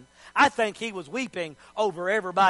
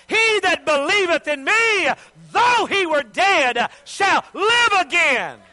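loud, high scriptural proclamation; ringing, drawn-out declaration; full-force preaching; 7 semitones higher, 6 dB louder, slower than usual. Acted. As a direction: proclaim it slowly to the whole church. Declaims Jesus' words, preaching.

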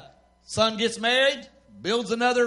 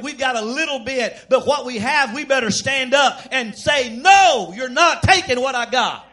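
loud, high defiant preacher shout; rising to a ringing shout; full-force preaching; 4 semitones higher, 9 dB louder than usual. Real, not acted. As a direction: thunder the refusal from the pulpit.

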